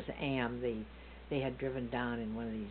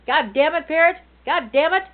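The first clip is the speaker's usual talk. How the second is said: loud cursing at the bird, pitched way up; mock yell at parrot; 18 dB louder than usual. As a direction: bellow the curse at the parrot. Uncle yelling at zoo parrot.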